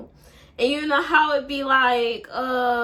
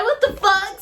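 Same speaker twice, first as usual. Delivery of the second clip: higher, louder mock outrage; angry outburst; 10 semitones higher, 8 dB louder than usual. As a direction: blurt it out, furious. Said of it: "I'm mad".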